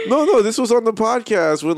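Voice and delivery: usual speaking voice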